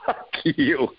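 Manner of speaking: teasing, breaking into a laugh; playful tease. Shown as a demonstration. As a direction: say it like a joke between friends and laugh. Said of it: "the tone is different".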